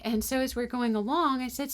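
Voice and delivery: ordinary talk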